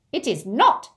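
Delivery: loud, high goblin contradiction; heated goblin denial; angry goblin. Acted. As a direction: cut them down indignantly.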